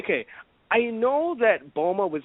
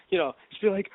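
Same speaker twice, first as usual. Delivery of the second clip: ordinary delivery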